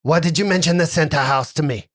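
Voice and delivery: put-on French-accented, uneasy demand; wild-eyed French accent. Captain reacts to the center house, uneasy French accent. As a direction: question sharply in a French accent. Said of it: "eyes almost go a little bit more wild".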